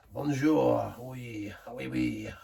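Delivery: cheesy French "Bonjour, oui, oui" in a put-on accent; mock French accent. Host apologising to French viewers, then mock French accent. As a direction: ham up a tourist's French. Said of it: "I apologise to anyone ... it's French".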